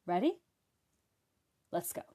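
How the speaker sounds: usual speaking voice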